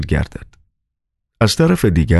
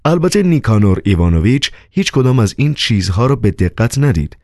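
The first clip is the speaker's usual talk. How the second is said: ordinary delivery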